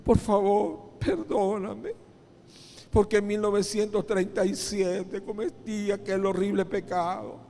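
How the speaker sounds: high, acted crying plea for forgiveness; tearful pleading. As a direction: beg God as if weeping, pitch held up. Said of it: "le decía llorando". (súplica llorosa, imitando a un penitente)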